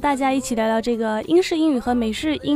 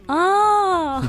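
long, raised "oh" of sudden understanding; drawn-out higher "oh" as it finally clicks; a retold aha moment; retold realization; 6 semitones higher, 4 dB louder than usual. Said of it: "I was like".

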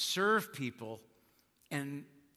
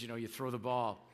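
usual speaking voice

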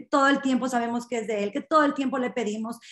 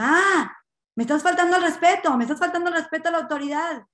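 acted indignation, higher, louder, rushed; offended police officer; 5 semitones higher, 4 dB louder, faster than usual. Policeman thinks he was disrespected, indignant outburst. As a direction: snap indignantly in a quick raised voice. Policía ofendido reclama respeto.

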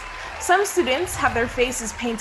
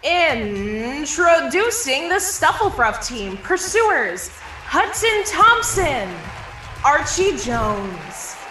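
loud, drawn-out player introductions; stadium announcer; 5 semitones higher, 4 dB louder, slower than usual. Acted. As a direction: stretch each name like a sportscaster. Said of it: "[name] introduces each player".

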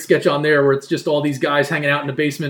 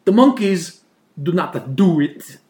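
Italian mother; slower than usual. Retold or imitated. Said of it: "It's like".

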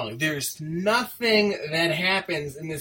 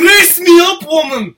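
high, very loud Scottish bellow; full-force cartoon Scottish yell; Groundskeeper Willie; 12 semitones higher, 16 dB louder than usual. Co-host demonstrating the accent to host, Scottish shout. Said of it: "that's groundskeeper Willie".

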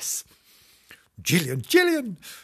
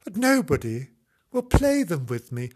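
plain reading voice

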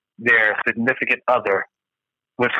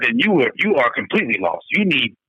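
ordinary delivery

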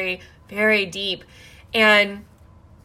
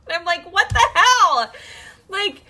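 high, incredulous exclamation through laughter; laughing disbelief; 10 semitones higher, 4 dB louder than usual. Real, not acted. Laughing at her own disbelief.

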